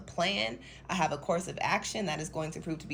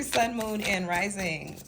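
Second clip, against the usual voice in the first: ordinary delivery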